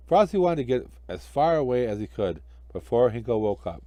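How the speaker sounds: ordinary delivery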